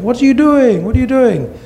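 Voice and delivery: high, frantic inner plea; alarmed kid. Retold or imitated. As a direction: panic in your head, pitched high.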